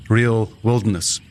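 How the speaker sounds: ordinary talk, nothing marked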